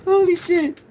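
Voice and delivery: high, loud laughing exclamation. Real, not acted. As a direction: crack up at yourself. Cracking up at own impression.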